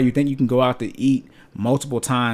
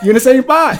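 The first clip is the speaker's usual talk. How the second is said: high and louder, breaking into real laughter; laughing out loud; 12 semitones higher, 7 dB louder than usual. Real, not acted. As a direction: laugh your way through the punchline. Cracking up mid-rant.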